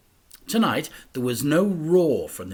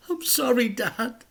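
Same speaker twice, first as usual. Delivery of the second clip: put-on boy's voice; 12 semitones higher than usual. A narrator's reading. Boy answers injured father.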